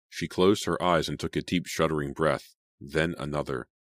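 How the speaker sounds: usual speaking voice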